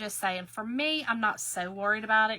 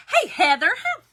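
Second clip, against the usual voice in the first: high, loud happy hello; excited greeting; 7 semitones higher, 3 dB louder than usual. Real, not acted. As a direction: greet a friend with delight. Greeting a live viewer.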